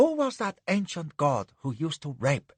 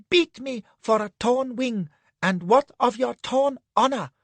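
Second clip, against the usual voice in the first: much higher, urgent man's voice; passionate reproach; 10 semitones higher than usual. Acted. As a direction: throw her honor at her. Anne passionate.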